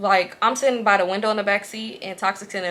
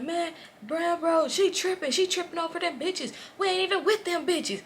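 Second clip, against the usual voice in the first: high, softer mock protest; pitched-up whiny excuse; complaining boyfriend; 8 semitones higher, 7 dB quieter than usual. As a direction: imitate a man explaining himself to his buddy. Voicing man complaining to friend.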